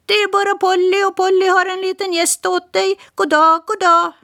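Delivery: high, quick parrot voice; chatty parrot. Acted. Parrot introducing herself to Smirke, high bird voice. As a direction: rattle it off like a talkative bird. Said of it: "sa papegojan".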